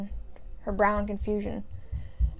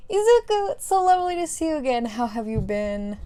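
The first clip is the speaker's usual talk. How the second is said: high, loud gush sliding down to usual pitch; delighted greeting; 5 semitones higher, 5 dB louder than usual. Acted. Mother greeting Izuku, gushing. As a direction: squeal the name, then ease off.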